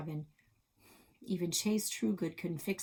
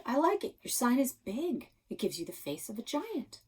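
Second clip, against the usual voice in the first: put-on sarcastic girl; 6 semitones higher than usual. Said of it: "Krista's sarcastic reply".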